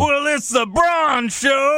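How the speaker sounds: high, drawn-out announcer-style bellow; mock entrance call. Co-host joking about a new Big Show, mock entrance bellow. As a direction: stretch it out like a big entrance theme. Sings out mash-up of entrance themes.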